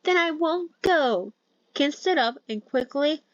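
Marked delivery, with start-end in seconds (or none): 0.0-1.3 higher, slow defiant refusal; stubborn refusal, pitched high and slow. Acted. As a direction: dig in, refusing to budge. Defiant reply in argument.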